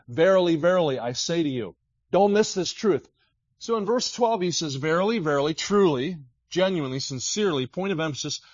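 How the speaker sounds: fiery preaching; full-force preaching. Acted. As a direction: hammer home each phrase. Emphatic preaching.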